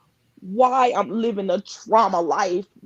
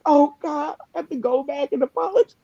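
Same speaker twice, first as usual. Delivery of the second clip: crying; 6 semitones higher than usual. Real, not acted. Real tears recounting her mistakes.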